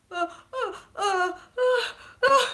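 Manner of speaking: high mock whimpering sobs; short, high fake sobs, building; demonstrated crying. Shown as a demonstration. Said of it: "my crying level. I'll show you".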